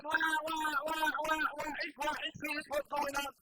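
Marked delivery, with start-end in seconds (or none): 0.0-2.2 mock baby crying, louder; baby wail imitation. Preacher imitates baby Moses refusing nurses, mock wailing. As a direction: wail like a newborn. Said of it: "Moses crying".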